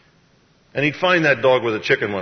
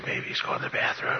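voiceless conspiratorial whisper; hushed divine prompting; 6 dB quieter than usual. Acted. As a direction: whisper the rude joke as a cue. God whispering lines to Elijah.

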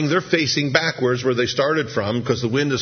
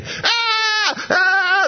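much higher mock scream; screaming apostles; 3 dB louder than usual. Apostles seeing the ghost on the water, shrieking. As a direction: shriek like terrified fishermen. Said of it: "the apostles all screaming".

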